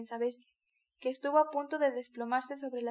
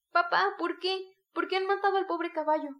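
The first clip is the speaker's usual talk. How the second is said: put-on higher, louder, pleading child's cry; sobbing child; 6 semitones higher, 6 dB louder than usual. A narrator's reading. Boy sobbing to his father about the horse, higher tearful plea. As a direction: cry out to papa in grief. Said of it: "sollozaba el niño".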